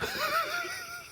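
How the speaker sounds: high-pitched burst of laughter. Real, not acted. Host laughs at the guest's talking topic, high giggling.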